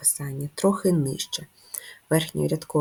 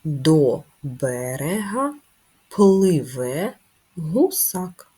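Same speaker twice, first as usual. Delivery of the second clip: slow reading aloud; 4 dB louder, much slower than usual. Shown as a demonstration. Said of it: "І в нас є речення".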